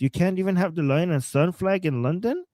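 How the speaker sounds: ordinary delivery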